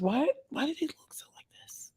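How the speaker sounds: whisper. Real, not acted. Looking at her under eyes, she whispers in dismay. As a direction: whisper it to herself, worried.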